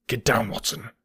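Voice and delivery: hushed urgent warning; understated, tense command; urgent cry. A narrator's reading. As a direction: warn a companion to hide, keeping it down. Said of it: "cried Holmes".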